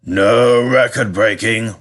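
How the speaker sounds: slow, firm fat controller caution; warning. Acted. Fat controller to the driver, warning him slowly. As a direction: lay down the rule slowly. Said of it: "warned the fat controller".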